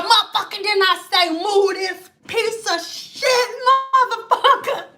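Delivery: hammed-up angry grandma, slowing and rising; mock furious. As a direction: order the car moved, drawing out the curse. Grandma attacking the car, retold.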